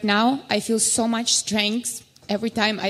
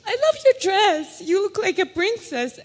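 put-on little girl; 7 semitones higher than usual. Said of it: "she's like".

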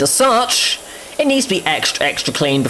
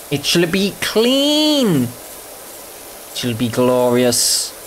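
mock grandeur; much slower than usual. Grand vow about cleaning.

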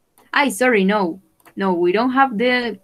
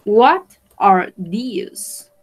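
very slow model question; 4 dB louder, much slower than usual. Shown as a demonstration. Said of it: "teacher models question very slowly".

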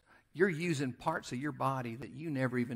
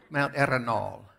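Arenal with a rolled Spanish R, a bit louder, with a laugh; Ricardo Montalban-ish; 3 semitones lower, 5 dB louder than usual. Shown as a demonstration. Preacher playfully shows the congregation the suave Spanish Arenal. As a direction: savor Arenal like a suave Spanish-accented star. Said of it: "doesn't it sound so Ricardo Montalban-ish".

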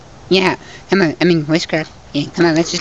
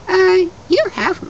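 high squeaky voice; 14 semitones higher than usual. Acted. Squeaky character voice.

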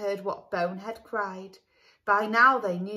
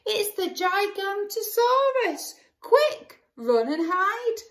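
high, loud panicked shout; high loud cry of fake alarm; 12 semitones higher, 4 dB louder than usual. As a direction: raise the alarm to friends. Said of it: "Bonehead cried".

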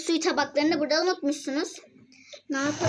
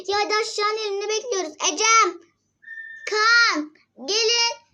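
calling out; 7 semitones higher, 3 dB louder than usual. Real, not acted. Mother calling kids downstairs.

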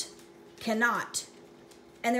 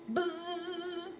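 buzzing gnat; 5 semitones higher, 5 dB quieter than usual. Acted. Imitating gnat.

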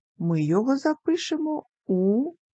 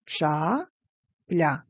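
two separate beats with a pause; syllable by syllable. Shown as a demonstration. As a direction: break Ча-пля into ча and пля. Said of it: "Ділимо на склади".

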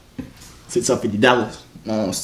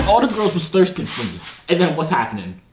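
ordinary talk, nothing marked

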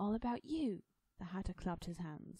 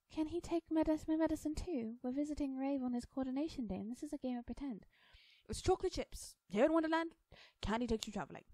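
put-on high, loud little-girl voice; high-pitched child character; eager young girl Alice; 8 semitones higher, 5 dB louder than usual. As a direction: chatter away as an imaginative child.

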